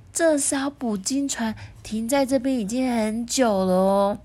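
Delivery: ordinary delivery, nothing marked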